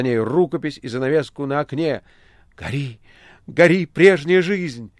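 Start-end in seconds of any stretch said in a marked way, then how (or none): 2.6-4.9 exultant cry. A narrator's reading. Margarita burns her former life, crying out. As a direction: build each phrase into an incantatory shout. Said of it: "кричала Маргарита".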